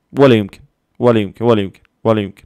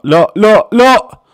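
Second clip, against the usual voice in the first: loud no, jumping higher each time; escalating shouts of no; 10 semitones higher, 9 dB louder, much slower than usual. Real, not acted. Fan watching a chance go, escalating shouts of disbelief. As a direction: build to a high yell. Refusing what happens in the match.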